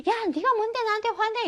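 high-pitched mock angry retort. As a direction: act out snapping back indignantly, voice way up. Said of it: "막 화내면". (높은 목소리로 화내는 척)

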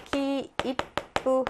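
키, 이쁘 very slow, beat by beat; deliberate three-count 키, 이쁘. Shown as a demonstration. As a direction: space out 키, 이쁘 with a held stop before 쁘. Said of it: "세 박으로".

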